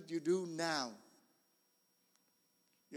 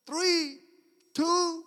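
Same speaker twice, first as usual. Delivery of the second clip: countdown shout; 9 semitones higher, 10 dB louder than usual. Retold or imitated. Counting down at the snake.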